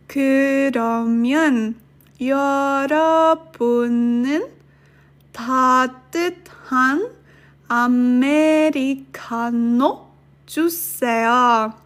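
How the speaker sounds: slow, word by word with long pauses. Shown as a demonstration. Teacher to students reading it slowly so they can practice along. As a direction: spell it out slowly so learners can follow. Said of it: "let me repeat that sentence slower".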